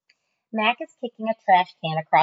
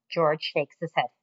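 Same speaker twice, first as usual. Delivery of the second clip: usual speaking voice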